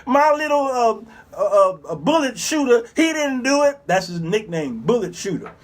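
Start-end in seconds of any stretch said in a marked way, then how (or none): 0.0-3.8 high wailing mock cry; mock weeping mother. Mother crying at court for her son, high mock wailing. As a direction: wail like a grieving mother in court. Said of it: "Who's the one crying and weeping".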